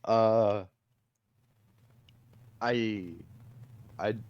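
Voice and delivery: stumbling, halting start; stuttering. Real, not acted. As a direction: trip over the first words. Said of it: "sorry, I'm stuttering".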